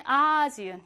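아지은 with a long stressed first 아. Shown as a demonstration. Teacher giving another example, 아지은 stressed on 아. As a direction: draw out 아 in 아지은, then a light ending. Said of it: "아의 강세가 오기 때문에".

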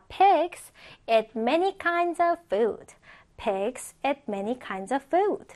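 slow, carefully spaced English example; slow model reading. Shown as a demonstration. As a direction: read it slowly for learners to follow. Teacher models example sentence for learners.

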